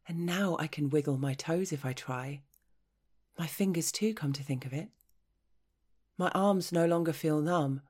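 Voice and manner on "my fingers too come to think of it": ordinary delivery, nothing marked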